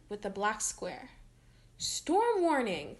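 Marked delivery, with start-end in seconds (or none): 1.8-3.0 high, slow, urgent cry of alarm; cried out. Acted. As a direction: stretch out the warning in alarm. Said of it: "cried Isabella".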